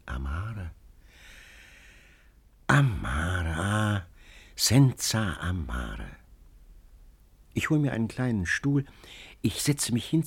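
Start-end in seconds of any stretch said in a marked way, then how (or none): 0.1-6.1 whispered, wistful amare; soft lisped sigh. A narrator's reading. Dogaressa murmurs to the wall, soft and wistful. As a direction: breathe it out low and slow. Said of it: "lispelt leise, ganz leise".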